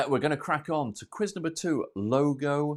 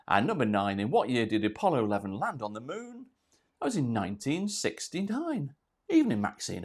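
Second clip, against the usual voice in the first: ordinary talk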